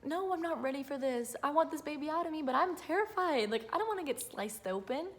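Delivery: high, pleading frightened voice; retold terror. Mother telling doctors she's not ready, high and scared. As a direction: beg them not to cut yet. Retelling her fear before surgery.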